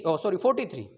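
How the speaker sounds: usual speaking voice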